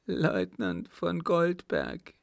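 high, slow tearful answer; name him in a drawn-out lament; storybook weeping girl, slow and high; acted sobbing. A narrator's reading. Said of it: "schluchzte die Gefragte".

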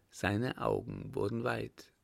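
usual speaking voice

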